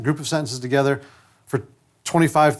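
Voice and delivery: ordinary talk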